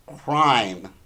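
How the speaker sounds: ordinary talk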